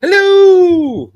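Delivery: high, loud, long-held hello; Alan. Acted. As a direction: belt out a high, drawn-out greeting. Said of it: "that's Alan. Uh, hello".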